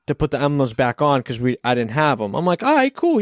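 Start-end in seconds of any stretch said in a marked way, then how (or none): 2.6-3.2 high, cheery all right cool; bright easygoing yes to a client; upbeat mock reassurance; breezy agreement.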